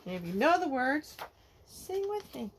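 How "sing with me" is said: ordinary delivery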